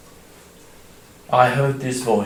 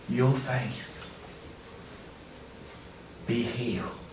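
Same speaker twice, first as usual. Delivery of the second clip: whispered, much softer, tender; gentle whisper; 4 semitones lower, 15 dB quieter, slower than usual. Acted. Jesus healing the blind man, gentle whisper. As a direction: breathe the blessing softly. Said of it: "his words so gentle".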